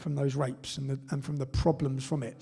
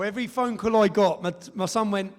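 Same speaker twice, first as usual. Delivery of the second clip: usual speaking voice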